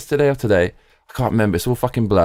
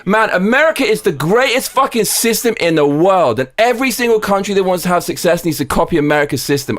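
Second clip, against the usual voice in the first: excited; 6 semitones higher than usual. Real, not acted. Streamer celebrating Trump election win.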